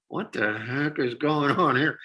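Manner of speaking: slow, drawn-out audience bafflement; mock bewilderment. Audience watching a crazy avant-garde show, imitated bewilderment. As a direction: stretch it out like a baffled onlooker.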